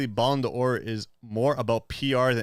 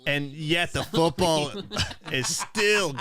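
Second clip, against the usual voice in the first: evil character voice; 3 semitones higher, slower than usual. Acted. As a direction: read it like a cartoon villain. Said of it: "It sounds like an evil character".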